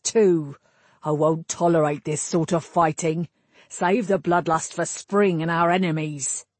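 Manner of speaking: put-on annoyed old captain of the guard; weary, commanding rebuke; gruff captain. Captain figure voiced.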